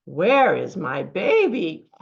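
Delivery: low, loud, slow mother pig; drawn-out deep question as a pig; pig character. Acted. Storyteller voicing a pig seeking her baby, low pig voice.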